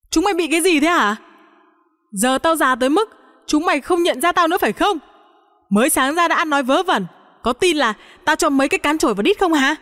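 high, fast scolding without much volume; exasperated grandma telling off the family; narrated angry yelling. A narrator's reading. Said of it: "gào lên". (gào mắng bực bội, giọng cao và nhanh)

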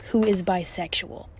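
ordinary delivery

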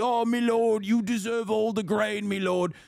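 grovelling peasant voice; bow and scrape like a medieval serf; imitating a servile peasant talking to his lord; mock peasant. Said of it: "you got real peasant brain".

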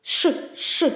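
Ш, ш hissed without voice; voiceless sh. Shown as a demonstration. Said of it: "Шарфик".

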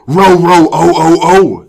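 frightened dog. Acted. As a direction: yelp it like a scared dog. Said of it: "said Towser in a frightened voice".

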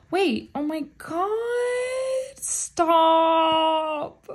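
a high, loud, near-tearful squeal; overwhelmed squeal. Real, not acted. Fan pulling a card she wanted, squealing near tears. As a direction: overcome with joy, nearly crying. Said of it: "I'm not crying".

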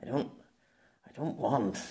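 soft, broken-off attempt; words catching. A narrator's reading. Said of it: "The words caught in his mouth".

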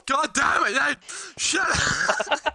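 loud, high, exasperated yell; annoyed shout. Real, not acted. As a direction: yell at your friend to shut up. Yelling at friend in game.

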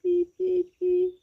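slow, high mouse peeps; hesitant piping mouse voice; squeaking mice. A narrator's reading. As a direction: draw out each tiny squeak. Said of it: "ще тихіше пропищали мишенята".

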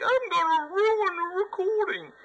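high squeaky mock voice; mocking the bugs. Gamer voicing the bugs that ruin his recording, high and mocking. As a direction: voice the bugs as smug pests. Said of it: "these fucking assholes are like".

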